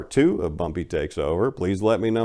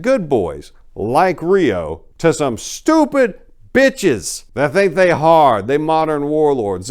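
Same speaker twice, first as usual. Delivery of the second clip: louder, rising indignation; angry rant; 5 semitones higher, 5 dB louder than usual. Real, not acted. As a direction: let the anger about the waste build. Angry rant about Memphis violence.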